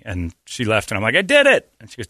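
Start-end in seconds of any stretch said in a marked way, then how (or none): 1.2-1.7 much higher, louder fake victory cry; mock triumph. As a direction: brag like a kid who got away with it. Faking a triumphant pee report.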